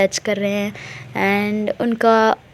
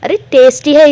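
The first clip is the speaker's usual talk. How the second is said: louder, eager exclamation; excited; 4 semitones higher, 8 dB louder than usual. Retold or imitated. Misha defends licking the wrapper, excitedly. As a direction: gush about the taste. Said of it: "वो बोलती है".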